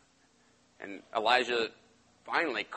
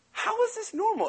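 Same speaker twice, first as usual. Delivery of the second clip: incredulous. Retold or imitated. Preacher voices his own disbelief.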